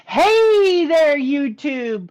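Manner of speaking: loud, high sing-song hello; loud greeting. Real, not acted. Host calls out to YouTube viewers, loud and high. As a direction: call out to the viewers.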